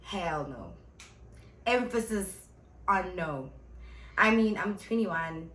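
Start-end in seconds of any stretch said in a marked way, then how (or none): none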